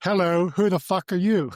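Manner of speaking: imitating an actor's brash greeting; gruff actor impression. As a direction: bark it as a cheeky mock challenge. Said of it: "he's like".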